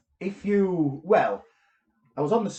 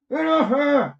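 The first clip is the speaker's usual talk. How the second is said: high, loud cry through a frozen mouth; strangled shout; 13 semitones higher, 10 dB louder, slower than usual. Retold or imitated. Paralysed man shouting at the intruder by his bed, loud strained yell. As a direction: yell it as if your jaw won't open. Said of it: "Like that, 'cause my mouth wouldn't move".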